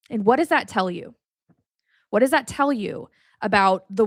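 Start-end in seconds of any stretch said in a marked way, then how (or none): none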